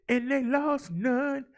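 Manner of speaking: slow, high sing-song on "lost none"; punching lost. Acted. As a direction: hit "lost" high and hold it. Stat called out with emphasis.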